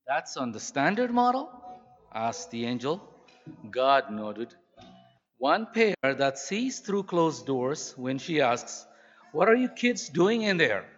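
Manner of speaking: warm, steady storytelling read; story reading. Acted. Preacher reading a story about mothers to the church, warmly. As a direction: tell the tale to the congregation with feeling. Reads story aloud to congregation.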